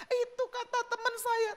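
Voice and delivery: high-voiced wife answering back; complaining wife. Istri menjawab pertanyaan gembala. (suara istri tinggi, membela diri)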